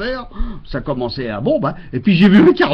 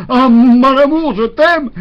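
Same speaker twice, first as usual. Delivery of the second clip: tender declaration; 7 semitones higher, 5 dB louder, slower than usual. Retold or imitated. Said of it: "j'ai dit enfin pouvoir déverser tout mon amour".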